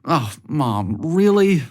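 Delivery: mildly groaning, pitch jumps on really; embarrassed. A narrator's reading. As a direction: cringe at your mom.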